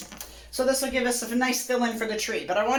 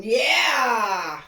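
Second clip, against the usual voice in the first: excited cheer. Real, not acted. Triumphant cheer after stroke.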